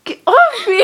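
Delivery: high, loud mock-annoyed shout; retold protest yell. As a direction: yell it at the kids hitting you, half laughing. Retold reaction to being hit.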